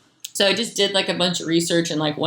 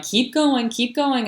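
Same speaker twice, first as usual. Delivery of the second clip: higher, lightly voiced coaxing guides; a gentle higher nudge to keep going; voices urging her on; 8 semitones higher, slower than usual. The guiding voices in her trip coax her on, higher.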